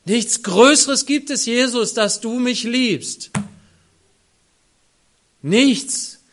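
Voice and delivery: loud, high impassioned outcry to Jesus; fervent prayer cry. Real, not acted. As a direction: pour it out in rapture.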